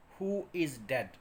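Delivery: usual speaking voice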